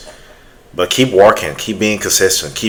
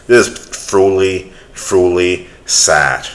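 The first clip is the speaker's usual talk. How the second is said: slow lament; slower than usual. Real, not acted. Closing lament.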